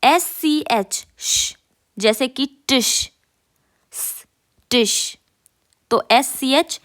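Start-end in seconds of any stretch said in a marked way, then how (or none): none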